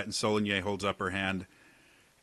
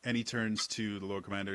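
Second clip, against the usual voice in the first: normal talking voice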